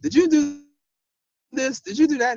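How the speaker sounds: mock a suspicious supervisor grilling you; a light take on a supervisor demanding answers; accusing supervisor. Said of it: "call back and be like".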